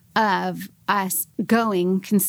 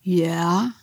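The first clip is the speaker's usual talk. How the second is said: tentative "yeah", rising at the end; scared admission. Retold or imitated. Boyfriend admitting his faith to girlfriend, scared and tentative. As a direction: imitate a nervous confession. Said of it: "he looks all scared".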